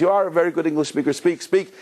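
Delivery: put-on quick, high-pitched Yiddish-accented urging. Rabbi pressing the uncle to speak, high Yiddish accent. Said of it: "with the Yiddish accent though".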